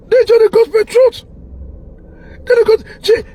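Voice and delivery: high excited cry of emphasis; excited high outburst. Real, not acted. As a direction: squeal it out in excitement.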